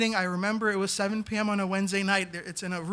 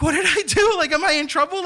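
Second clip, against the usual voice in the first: much higher and louder, mock panic; panicked; 8 semitones higher, 7 dB louder than usual. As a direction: blurt out a nervous fear of trouble. Panicked inner thoughts.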